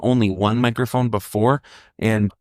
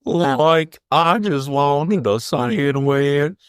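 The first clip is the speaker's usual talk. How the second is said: slow, higher twangy country voice; Shaggy voice; 4 semitones higher, much slower than usual. Pretend. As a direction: drag it out like Shaggy.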